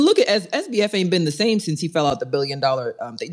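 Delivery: ordinary talk, nothing marked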